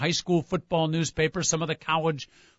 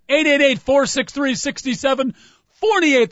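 radio announcer voice; 9 semitones higher, 6 dB louder than usual. Acted. Phone number read in announcer voice.

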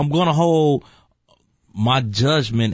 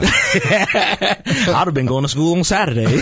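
higher, slow, laughing delivery; real amused laughter through the words; laughing joke; 8 semitones higher than usual. Real, not acted. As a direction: crack up while saying it. Joking reaction, laughter on the line.

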